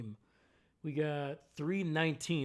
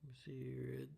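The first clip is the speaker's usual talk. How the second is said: breathy whisper, talking to himself; 10 dB quieter than usual. Real, not acted. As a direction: whisper it while you check the news. Checking the news to himself.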